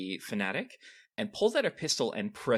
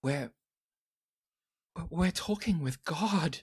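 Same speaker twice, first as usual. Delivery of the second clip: horrified; 6 semitones higher than usual. Acted. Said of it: "looks kinda horrified".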